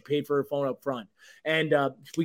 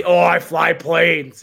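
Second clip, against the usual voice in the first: slow, louder mocking boast of an action star; mock Harrison Ford; 9 dB louder, much slower than usual. Mocking Harrison Ford to friends, slow loud smug brag.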